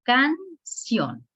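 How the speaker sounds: "Canción" split slowly into can and ción; teacher's demonstration of "Canción", syllable by syllable; syllable stress demonstration. Shown as a demonstration. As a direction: sound out "Canción" in two separate beats, can, then ción. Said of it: "La última sílaba se escucha más fuerte".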